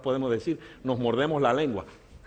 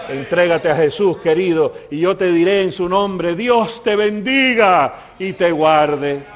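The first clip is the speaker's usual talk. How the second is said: loud, slow, high proclamation; full-force benediction over a congregation; preached blessing; 6 semitones higher, 6 dB louder, slower than usual. Real, not acted. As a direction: proclaim the blessing, stretching each phrase.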